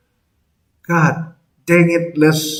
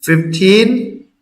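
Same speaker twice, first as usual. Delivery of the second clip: usual speaking voice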